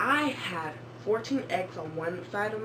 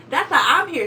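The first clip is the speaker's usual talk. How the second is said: loud joke; 8 semitones higher, 12 dB louder than usual. Real, not acted. Joking punchline, loud.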